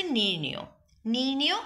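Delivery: নির্ণেয় said with a final o, nirnio; o-ending pronunciation. Shown as a demonstration. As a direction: end নির্ণেয় on an o sound. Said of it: "এটা ওকারের মতো উচ্চারিত হচ্ছে". (নির্ণেয় শেষে ও-কারের মতো উচ্চারণ)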